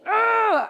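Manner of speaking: loud frustrated groan; exasperated ugh. Retold or imitated. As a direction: groan it out in disgust.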